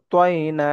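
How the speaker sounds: তয়না with rounded lips on ta; heavy rounded ta. Shown as a demonstration. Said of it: "ঠোঁট গোল করে পড়ব".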